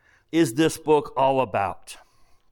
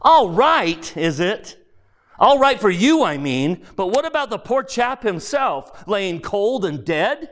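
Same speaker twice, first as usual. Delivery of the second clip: indignant big ghost; 5 semitones higher than usual. Acted. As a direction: throw the accusation back at him. Big ghost protesting the murder.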